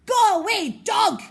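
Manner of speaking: high, loud fierce howl; horrid howl. A narrator's reading. Boy howling to scare off a dog, loud and fierce. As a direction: roar the dog away. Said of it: "he howled in the most horrid voice".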